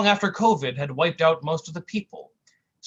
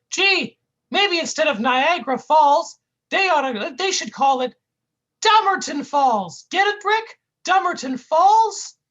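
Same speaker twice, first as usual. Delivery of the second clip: high goofy cartoon whine; 13 semitones higher, 8 dB louder than usual. Sidekick whining at his mean partner, high goofy voice. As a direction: plead like a hurt buffoon.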